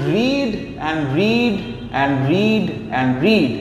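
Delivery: slow, stretched stress on each "read"; emphatic repetition. Shown as a demonstration. Teacher showing the poet's emphasis on a repeated word, slow and emphatic. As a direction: lean on every "read", drawing it out evenly. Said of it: "he wants to emphasize on the word read".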